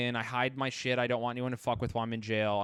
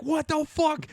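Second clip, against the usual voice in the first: high, mock-astonished outburst; shocked friend; 12 semitones higher than usual. Friend walking in on the fight, shocked. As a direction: imitate a shocked friend, pitch jumping high.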